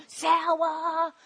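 drawn-out imitated child's call; kids calling out. Teacher imitating kids on the bus, long drawn-out call. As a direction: stretch the word out on a level note. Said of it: "I hear".

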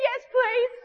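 high, eager imitation of a girl. Girl accepting the offer to learn his name, high and eager.

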